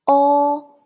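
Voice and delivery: O high and level; first tone. Shown as a demonstration. Teacher drilling second line, o high flat. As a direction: hold O loud on a high pitch. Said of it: "And then the second line".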